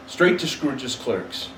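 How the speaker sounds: usual speaking voice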